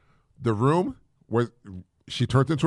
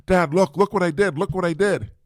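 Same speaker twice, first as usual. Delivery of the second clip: higher-pitched imitation of an eager daughter; proud and happy; 4 semitones higher than usual. Daughter showing dad her finished chair, imitated pride. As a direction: show off your work to Dad, happily. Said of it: "really proud and happy".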